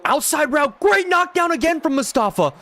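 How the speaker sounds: rising into a high, loud yell; thrilled play-by-play outburst; excited shout. Real, not acted. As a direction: shout it as the pass is knocked down. Big defensive play, live reaction.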